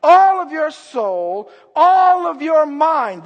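loud, high, drawn-out preaching; impassioned, slow scriptural proclamation; passionate. Acted. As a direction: stretch and belt out each ALL. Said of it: "That's passion".

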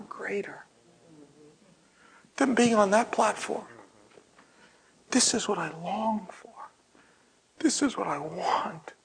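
whispered prayer. Real, not acted.

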